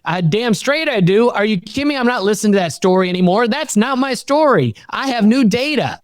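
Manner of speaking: high, fierce defiance; high indignant comeback; defiant retort. Retold or imitated. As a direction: talk back, fired up.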